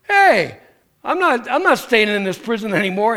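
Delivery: triumphant cry of a freed prisoner; bold breakout. Retold or imitated. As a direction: burst out like someone leaving a cell. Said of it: "and you're like".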